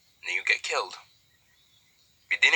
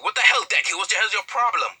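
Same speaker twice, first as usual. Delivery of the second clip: annoyed outburst; 8 semitones higher than usual. Acted. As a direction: snap at him for waking you. Hinata dragged from bed.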